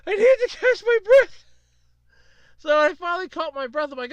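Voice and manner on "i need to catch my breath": high, loud, gasping for air; squeezed out between gasps of mock-helpless laughter; breathless laughing. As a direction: act out laughing too hard to talk. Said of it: "catch my breath 'cause I'm laughing so hard".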